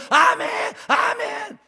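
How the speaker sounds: high, loud fervent amens; shouted amen. Retold or imitated. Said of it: "애들이 막 두 손 들고".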